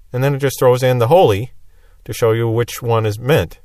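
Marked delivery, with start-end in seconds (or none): none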